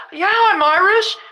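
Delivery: lightly hammed-up Irish accent; put-on Irish accent. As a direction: answer in a playful Irish accent. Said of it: "you gotta do your Irish accent".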